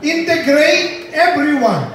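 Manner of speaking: preacher hammering out numbered points; forceful preaching. Acted. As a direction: drive each point home to the church.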